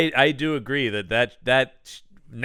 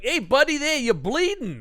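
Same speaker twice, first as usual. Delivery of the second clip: Ralph's voice; 11 semitones higher than usual. Retold or imitated. Ralph points out Jeremy's bleeding, high and chirpy. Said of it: "Ralph is like".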